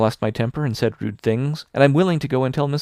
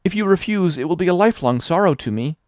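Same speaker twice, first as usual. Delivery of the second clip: high, loud, overwrought pleading; 7 semitones higher, 4 dB louder than usual. A narrator's reading. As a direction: throw herself on Mrs Lynde's mercy. Anne begs Mrs Lynde's pardon.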